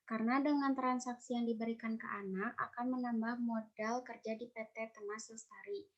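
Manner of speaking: usual speaking voice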